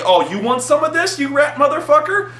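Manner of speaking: high, cocky taunt that climbs up. Retold or imitated. Swashbuckler taunting the Dormouse on the table, high and cocky. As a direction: square up to the rat with swagger. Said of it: "and I'm like".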